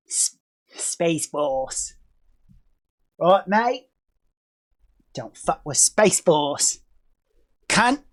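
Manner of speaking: put-on understated Australian accent, lingering on the vowels. Aussie space force warning off rivals, soft slow Australian accent. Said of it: "My, my Australian accent".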